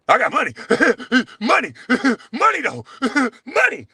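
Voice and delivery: high, laughing mockery of a rich braggart; sing-song taunt, laughing between the words; mocking. Taunting his rival on the show, high and laughing.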